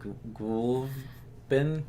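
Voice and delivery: slow, soft, stumbling Gwilvbin with a rising tune; halting try. Acted. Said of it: "too difficult to pronounce".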